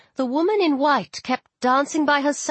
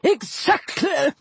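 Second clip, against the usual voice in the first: slow, high hissing snap; snapped; 4 semitones higher, slower than usual. A narrator's reading. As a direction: snap it like deflating air. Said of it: "the aviator snapped".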